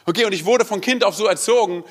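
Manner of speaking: usual speaking voice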